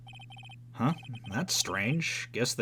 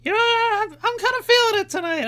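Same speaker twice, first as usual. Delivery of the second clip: put-on much higher, bubbly young girl; high-pitched girl voice; 6 dB louder than usual. Shown as a demonstration. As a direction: pitch way up into a girly voice. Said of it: "my high-pitched girl voice".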